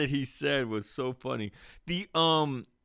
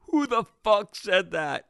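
higher, yelled barracks challenge; mock drill instructor yell; 8 semitones higher than usual. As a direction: snap it like a sergeant bursting in.

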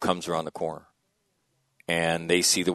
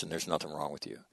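soft reassurance; 3 semitones lower, 12 dB quieter, faster than usual. Retold or imitated. Closing reassurance to young Marine.

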